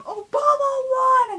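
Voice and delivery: shouting to sleeping parents in delight; excited scream. Retold or imitated. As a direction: burst in yelling the news. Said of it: "I screamed at them".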